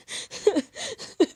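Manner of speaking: high, gasping mock sobs; hyperventilating crying. Teller attacks the beetle with a broom, hyperventilating crying. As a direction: act out panicked, hiccuping cry-breaths. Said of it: "I'm already crying".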